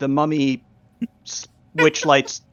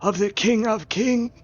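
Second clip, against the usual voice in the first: high, slow ceremonial decree; stretched, solemn undead proclamation; mummy herald; 6 semitones higher, slower than usual. Acted. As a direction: intone the demand for your king.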